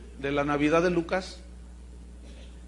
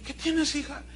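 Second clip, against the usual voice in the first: concerned mother; 8 semitones higher, 3 dB quieter than usual. Retold or imitated. Mother asks sobbing daughter.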